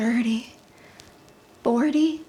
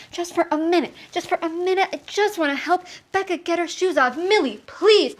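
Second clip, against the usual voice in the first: higher-pitched, flustered plea; frantic pleading; 6 semitones higher than usual. Acted. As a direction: plead for just one minute's peace. Overwhelmed, pleading with yelling child.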